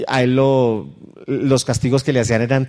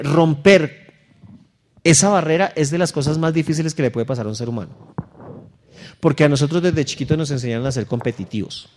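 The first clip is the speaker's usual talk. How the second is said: ordinary talk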